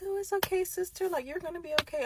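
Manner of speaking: high-pitched put-on comforting voice; soothing a crying sister. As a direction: coo reassurance in a high voice. Comforting her crying sister, re-enacted.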